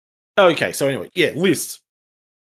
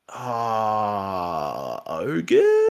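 mock wavering. Retelling a friend switching armies to ogres, mock wavering. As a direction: imitate someone tempted by a new idea.

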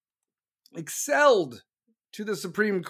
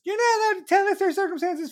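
high, fast whiny imitation of a critic; mock critic; 12 semitones higher, 4 dB louder, faster than usual. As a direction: mimic the objector in a shrill high voice. Mocking imagined critic.

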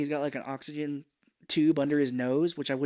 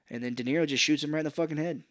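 usual speaking voice

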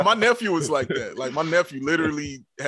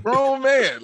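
mock grown man; 10 semitones higher, 5 dB louder than usual. Real, not acted. Echoing joke, exaggerated.

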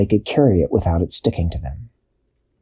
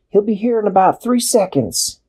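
much higher calm answer; high Dashi voice; 11 semitones higher than usual. A narrator's reading. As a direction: say it lightly as Dashi.

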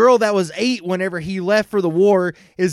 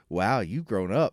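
low, soft leering remark; low creepy voice; 11 semitones lower, 7 dB quieter than usual. Retold or imitated. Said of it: "he has this".

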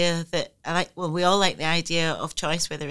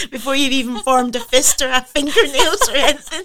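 high, loud, through real laughter; laughing; 8 semitones higher, 8 dB louder than usual. Real, not acted. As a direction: barely get the words out for laughing. Laughing at own womb joke.

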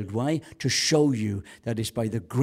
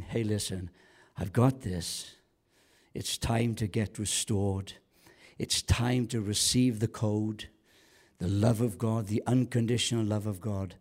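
gentle reassurance; 3 semitones lower than usual. Acted. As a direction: speak tenderly, giving each phrase room. Jesus gently speaking to the congregation.

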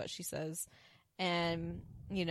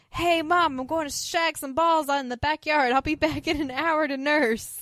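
put-on higher and louder, a breezy kid calling to mom; mock kid voice; 7 semitones higher, 6 dB louder than usual. Grown son telling mom he'll be back to nurse, breezy and loud. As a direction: play the overgrown son announcing his plans.

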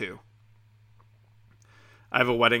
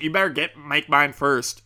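mock pushy customer; 3 semitones higher, 7 dB louder than usual. Acts out customer's pushy demand.

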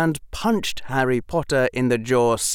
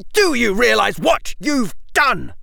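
higher, louder furious scream; 7 dB louder than usual. Acted. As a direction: scream it in outrage. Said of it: "His voice rose to a scream".

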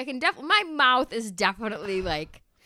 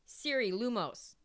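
slow command; 8 dB quieter than usual. Real, not acted. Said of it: "I have to hit Siri".

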